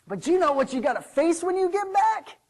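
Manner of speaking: high, forceful warning question; loud challenge. Acted. Servant warns the son about going back, loudly. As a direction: throw it at him as a challenge.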